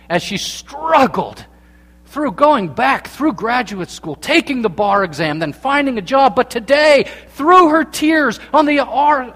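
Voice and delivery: preacher's impassioned crescendo; building fervent preaching. Real, not acted. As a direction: build the struggle into a triumphant proclamation.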